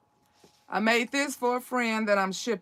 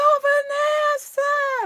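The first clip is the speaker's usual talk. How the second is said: very high, loud, drawn-out holler; excited call; 8 dB louder, slower than usual. Retold or imitated. Recovered manager spots Vanessa across the room, excited call. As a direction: call the name out in imitated elation.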